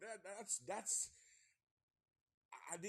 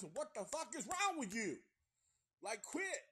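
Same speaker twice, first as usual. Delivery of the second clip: much higher and louder, yelling a warning; exasperated shout; 11 semitones higher, 6 dB louder than usual. Acted. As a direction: scream at the fools on screen. Yelling at the movie townsfolk.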